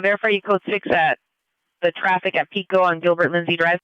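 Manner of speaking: ordinary delivery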